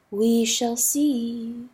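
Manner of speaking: slow calm, soft; calmly. Acted. River answering rooster, slow and calm. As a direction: say it slowly, untroubled. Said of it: "the river sang calmly".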